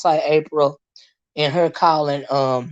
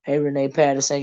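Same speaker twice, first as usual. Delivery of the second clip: normal talking voice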